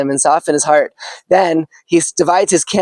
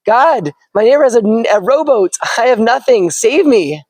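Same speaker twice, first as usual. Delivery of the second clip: higher, mildly anxious plea; pleading prayer; 6 semitones higher than usual. Acted. Stranded man complains to God, mildly pleading. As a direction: press God a little harder.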